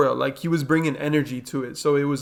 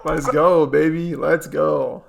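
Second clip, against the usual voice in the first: hyped up; slower than usual. Retold or imitated. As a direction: cheer it like a fan. Echoing hype clip.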